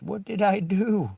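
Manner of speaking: high, loud whiny protest; yelling, playing the innocent; mock yell. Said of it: "like, yelling at the cops".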